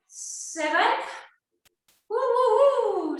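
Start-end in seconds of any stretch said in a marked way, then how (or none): 2.1-3.2 cheering woos. Real, not acted. Cheering through hard set.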